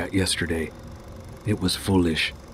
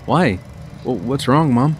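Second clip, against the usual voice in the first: put-on higher, puzzled kid; young boy voice; 6 semitones higher, 3 dB louder than usual. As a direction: ask like a confused kid. Boy questions mom.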